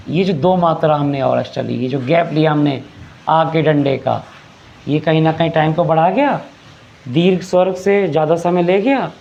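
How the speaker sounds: normal talking voice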